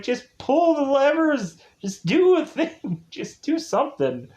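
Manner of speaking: high, pleading, exasperated push; exasperated urging. Real, not acted. Teller urging the clueless driver, high and exasperated. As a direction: beg him to just do anything.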